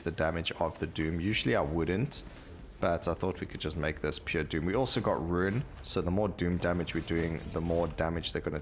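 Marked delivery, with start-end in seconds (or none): none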